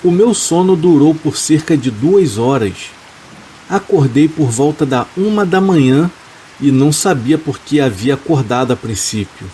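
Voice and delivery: plain reading voice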